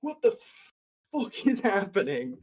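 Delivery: high, panicked cry; panicked crowd. Acted. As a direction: shriek it like a startled bystander. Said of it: "everyone's just like".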